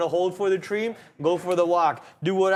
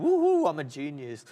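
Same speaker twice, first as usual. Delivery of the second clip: mock self-praise; 5 semitones lower than usual. Real, not acted. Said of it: "I'm very proud of myself".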